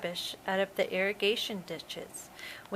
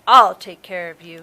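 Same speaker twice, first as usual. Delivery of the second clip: stern threat; 2 semitones lower, 14 dB louder than usual. A narrator's reading. Man confronts the shark.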